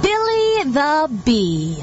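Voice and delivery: slow, airy voice of nature; wind-rustle voice. Acted. Mother Nature calling the bee, slow and windy. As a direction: let the name drift like wind. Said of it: "wind rustle voice of Mother Nature".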